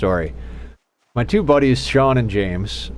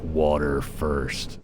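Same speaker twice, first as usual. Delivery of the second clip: much lower, very slow, soft gruff croak; gruffest hungover voice; 8 semitones lower, 7 dB quieter, much slower than usual. Retold or imitated. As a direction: groan it out like the worst hangover ever. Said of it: "in the gruffest, most hungover voice".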